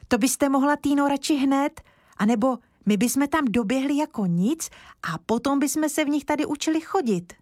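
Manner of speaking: put-on high, quick boyish pleading; a little boy begging to run outside; eager boy Kája. Kája voiced as child. (vysoký dychtivý chlapecký hlas)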